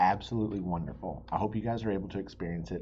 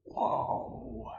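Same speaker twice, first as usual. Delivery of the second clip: higher, wide-eyed gasp of wonder; amazed whoa. Acted. Pete the explorer awed atop the pyramid, excited whoa. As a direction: marvel at the view from the top.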